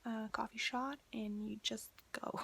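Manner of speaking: ordinary delivery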